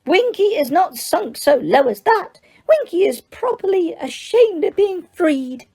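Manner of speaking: high, squeaky indignant elf. Acted. Winky answers Hermione with an offended squeak. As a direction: squeak it out, hurt and proud.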